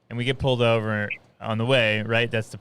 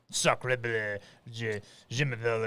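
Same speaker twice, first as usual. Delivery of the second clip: quick demo French accent, understated; 3 semitones lower, 7 dB quieter than usual. Shown as a demonstration. Showing a phrase to get into it, French accent. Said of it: "French accent, it's actually".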